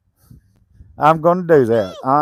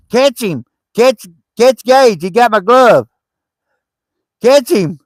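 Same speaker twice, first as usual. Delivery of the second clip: urging a dog on; 6 semitones higher, 4 dB louder than usual. Real, not acted. Urging dogs to chase.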